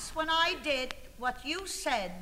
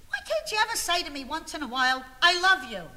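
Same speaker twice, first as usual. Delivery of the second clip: exasperated plea; 4 semitones higher, 6 dB louder than usual. Acted. Wife pleads with husband for affection.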